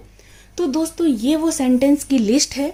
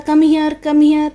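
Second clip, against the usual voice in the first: louder call, pitched up to carry; 3 semitones higher, 6 dB louder, much slower than usual. Pretend. Mother calling her child to come, loud call. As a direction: call the child over from across the room.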